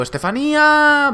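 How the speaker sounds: high, drawn-out mock wail; imitated TV catchphrase yell; hammed-up name cry. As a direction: stretch the name into a dramatic call. Same catchphrase from the tattoo story.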